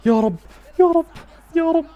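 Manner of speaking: high, loud, breathless little prayers; urgent pleading. Real, not acted. Fan praying as the attack develops, urgent high pleading. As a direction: plead loudly for the goal.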